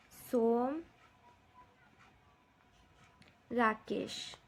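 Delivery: single words with long writing pauses; slow dictation. Shown as a demonstration. Teacher listing names of the moon for children, slow dictation. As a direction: list them one at a time for copying. हम लिखेंगे.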